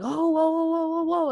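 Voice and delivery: high, startled stranger backing off from the dogs; mock panicked stranger. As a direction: throw up a high whoa as the dogs charge. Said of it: "the dude's like".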